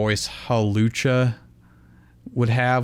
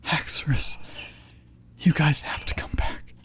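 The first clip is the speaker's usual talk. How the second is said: soft unvoiced whisper, pleading; 5 semitones higher, 5 dB quieter than usual. A narrator's reading. Iris whispering to Haxorus to come back. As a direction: whisper the plea under your breath. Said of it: "Iris whispered".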